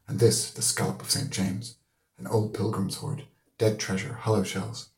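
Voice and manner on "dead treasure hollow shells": usual speaking voice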